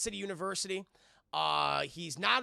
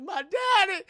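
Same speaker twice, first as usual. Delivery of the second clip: high loud whiny cry; hammed-up sad wail; mock wail; 11 dB louder, slower than usual. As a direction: wail like a hurt kid. Victor misses his dad.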